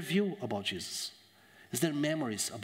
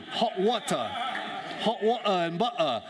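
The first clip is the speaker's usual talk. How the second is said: hammed-up Cockney, higher and punchy; Cockney accent; 6 semitones higher, 3 dB louder than usual. Shown as a demonstration. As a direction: drop the t's in "water" and "butter" like a Cockney. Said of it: "with the Cockney accent".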